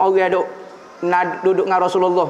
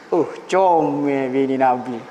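slow, low, admiring murmur; drawn out like a smitten bedouin; a hint of a leering bedouin; 7 semitones lower, slower than usual. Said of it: "badui tu tengok bini Nabi". (suara badui yang terpesona, perlahan)

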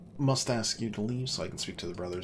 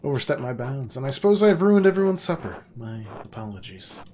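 mock apology; 4 semitones higher, 8 dB louder than usual. Guest voiced, sarcastic apology.

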